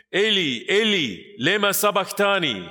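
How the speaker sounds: high, anguished cry of Eli, Eli; loud cry. A narrator's reading. Jesus on the cross crying out to God, high loud cry. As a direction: call it out high to the heavens. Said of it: "cried out with a loud voice".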